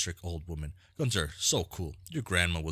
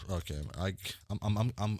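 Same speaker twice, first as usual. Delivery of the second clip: ordinary talk, nothing marked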